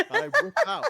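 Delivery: high giggly laugh through words. Real, not acted. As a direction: crack up while telling. Laughing while retelling.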